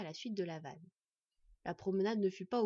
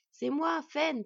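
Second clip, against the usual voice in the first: raised, higher voice to be heard; calling out; 6 semitones higher, 8 dB louder, much slower than usual. Acted. Wolf woman to the dwarf inside, calling out. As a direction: announce yourself through the door. Calling through a closed door.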